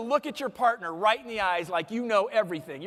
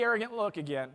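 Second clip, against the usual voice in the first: ordinary talk, nothing marked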